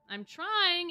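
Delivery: strained through gritted teeth. Acted. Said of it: "with gritted teeth".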